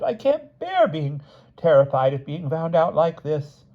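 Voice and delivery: high, slow tearful voice; sobbing. A narrator's reading. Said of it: "Missus Smith sobbed".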